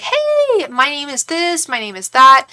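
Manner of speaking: high, sing-out mock hello; friendly greeting. Nice friends introducing themselves in the kitchen, mock friendly greeting. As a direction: imitate over-friendly introductions, starting very high.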